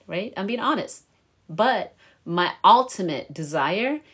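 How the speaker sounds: ordinary talk, nothing marked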